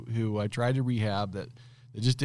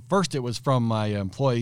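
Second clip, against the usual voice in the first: ordinary delivery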